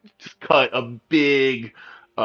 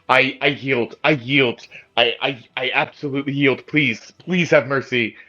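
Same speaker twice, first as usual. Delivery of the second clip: higher, stammering plea for mercy; acted frantic begging, voice raised in pitch; pleading surrender; 4 semitones higher than usual. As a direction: beg for your life as a surrendering elf. GM acts surrendering elf begging mercy.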